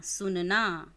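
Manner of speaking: normal talking voice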